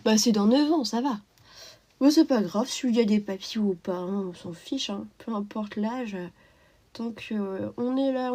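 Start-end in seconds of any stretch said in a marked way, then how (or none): none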